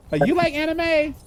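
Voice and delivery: high-pitched retold curiosity; imitated woman's question.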